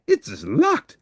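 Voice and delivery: very high alarm; a monster finding the door locked; dismayed gasp. Acted. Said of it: "gasped Grover".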